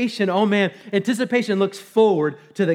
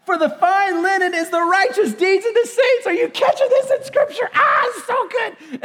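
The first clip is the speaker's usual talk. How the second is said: much higher, near-shouting with laughter; overjoyed; 8 semitones higher than usual. Real, not acted. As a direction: reread it bursting with joy. Rereading verse, overcome with joy.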